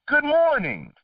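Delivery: high-pitched sing-out greeting; retold cheery greeting. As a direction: imitate yourself greeting people, pitched well up. Retold friendly morning greeting.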